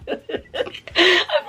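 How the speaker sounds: bright real laugh up high; high burst of laughter. Real, not acted. Laughing at husband's car complaint, high laugh.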